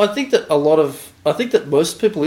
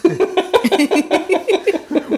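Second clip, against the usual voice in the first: long high laughter; 14 semitones higher than usual. Real, not acted. Laughs at tease.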